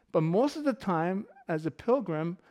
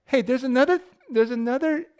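higher, louder voice of a friendly local; imitated local; 7 semitones higher, 6 dB louder than usual. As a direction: call out like a local with a tip. Imitating the excited Hawaiian local.

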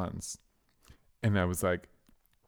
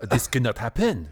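put-on French accent; 3 semitones higher, 4 dB louder than usual. Mimicking French woman.

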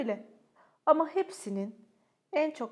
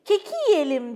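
higher, louder, slow eager call; enthusiastic; 6 semitones higher, 7 dB louder than usual. A narrator's reading. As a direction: invite everyone to eat with glee. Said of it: "dedi Fergus coşkuyla".

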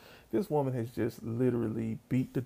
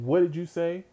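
mock stern "say that to me" challenge; higher-pitched retold demand from a mom; lightly acted confrontational question; challenging mother; 5 semitones higher, 4 dB louder than usual. Mother challenges child's muttered insult.

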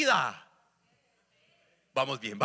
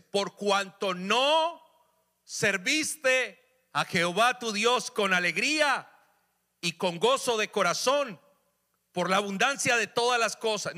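slow, loud, high scripture reading; fiery pulpit reading of the verse; forceful preaching; 5 semitones higher, 4 dB louder, slower than usual. Acted. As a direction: proclaim each word of the verse.

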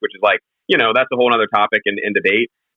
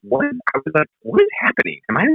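confused disbelief; 7 semitones higher than usual. Retold or imitated. Retold own confusion at rug.

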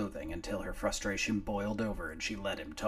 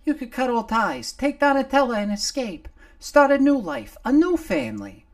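much higher, louder pleading little voice; urging little Nomar; 16 dB louder than usual. Acted. Nomar urging his boss to flee, high and loud. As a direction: push the boss toward a new life.

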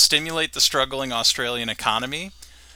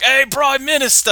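calling out; 13 semitones higher, 4 dB louder than usual. Retold or imitated. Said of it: "They're like".

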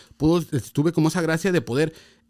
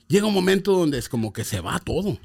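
ordinary delivery

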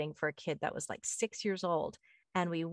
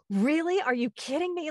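shocked; 9 semitones higher, 4 dB louder than usual. Retold or imitated. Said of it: "I'm shocked when I get it right".

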